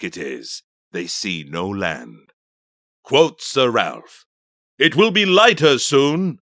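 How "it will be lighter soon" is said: raised, louder, higher voice; confident captain's call. Acted. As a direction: call it out assuredly to the crew. Captain calls to crew at night.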